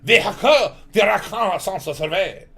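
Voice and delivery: loud, slow, exaggerated French; mock French accent. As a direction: ham up the French pronunciation. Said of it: "like, Parisian France".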